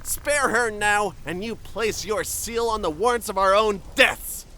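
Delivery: forceful, loud demand building to a shout; angry urging. Acted. Urging a comrade to kill the captive, angry and insistent. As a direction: press harder until you are shouting the order.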